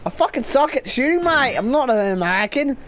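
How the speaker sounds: ordinary talk, nothing marked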